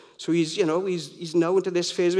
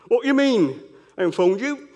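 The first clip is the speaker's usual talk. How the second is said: put-on Cockney accent; 5 semitones higher than usual. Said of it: "That was a Cockney accent".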